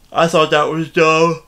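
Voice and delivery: words stretched through a yawn; yawning mid-sentence. Real, not acted. Reviewing the movie to viewers, caught in a yawn. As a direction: yawn through the line and keep talking. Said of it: "Excuse me, I thought that was dumb".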